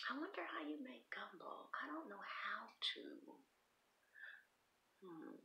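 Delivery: unvoiced whisper to herself. Real, not acted. Wondering about gumbo in a whisper. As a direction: whisper the thought, barely there.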